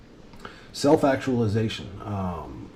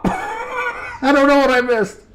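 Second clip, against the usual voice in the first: high, loud mock yell of defeat; frazzled squeal of cluelessness; exasperated outburst; 8 dB louder, slower than usual. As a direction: blurt it like your brain is fried. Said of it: "and I go".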